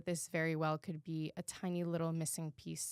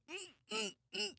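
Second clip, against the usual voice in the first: tight high hums holding in tears; held-back sob; 13 semitones higher, 6 dB quieter than usual. Retold or imitated. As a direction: imitate choking back tears. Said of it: "trying to keep it in".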